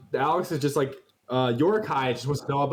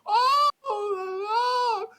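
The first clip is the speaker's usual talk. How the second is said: very high sobbing cries of he's dead; overacted mourning, half laughing; a high mock-wail over the body; hammed-up wailing grief; much slower than usual. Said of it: "he's like".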